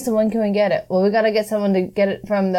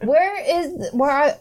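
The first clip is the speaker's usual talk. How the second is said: laughing call; 8 semitones higher, slower than usual. Retold or imitated. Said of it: "And we called, like".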